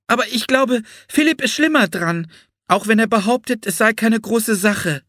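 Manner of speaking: put-on high-pitched little girl's voice; young Anne, concerned. A narrator's reading. Anne tells Florence that Philipp is worse hurt, high girlish voice. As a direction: speak up anxiously for a brother.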